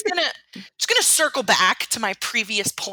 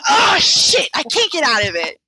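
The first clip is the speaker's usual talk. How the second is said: mock exasperated outburst; 2 semitones higher, 5 dB louder than usual. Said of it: "where I'm like".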